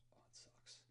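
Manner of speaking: breathy whispered aside; whispering. Real, not acted. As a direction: whisper it to yourself. Aside during tense scene.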